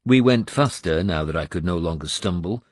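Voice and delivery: ordinary delivery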